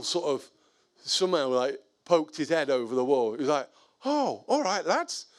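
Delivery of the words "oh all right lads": high, lilting mock-innocent hello; sing-song retold greeting; cheery greeting. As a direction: imitate a neighbour greeting the lads, pitched way up. Neighbour pops head over wall.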